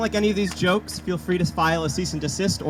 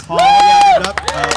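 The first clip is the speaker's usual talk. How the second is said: high, loud cheer for an island legend; cheering her on; 11 dB louder than usual. Real, not acted. Host getting applause for Missus Hall, excited cheer. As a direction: whoop her name to the crowd.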